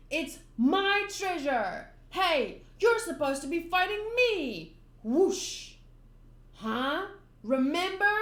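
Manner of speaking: loud, high, slow first line; indignant yelling. Acted. Clown yelling at Luffy, loud and high. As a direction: yell to get his attention.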